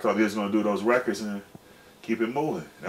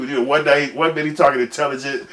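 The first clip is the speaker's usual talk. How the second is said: normal talking voice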